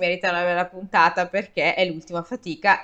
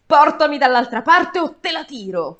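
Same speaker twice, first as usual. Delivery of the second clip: higher, louder threat to the ferryman; threatening shout; 7 semitones higher, 4 dB louder than usual. Acted. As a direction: bark the threat at him. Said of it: "urlò". (urlo minaccioso al traghettatore)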